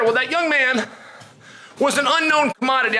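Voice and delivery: ordinary talk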